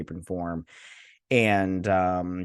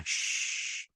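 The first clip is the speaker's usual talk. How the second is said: hushing shh; 8 dB quieter than usual. Retold or imitated. Character hushing behind door.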